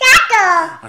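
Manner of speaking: excited exclamation. Acted. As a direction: cheer the word like a delighted child. Excited toddler-book game.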